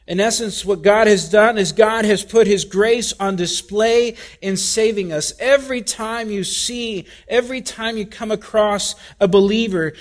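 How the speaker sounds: ordinary delivery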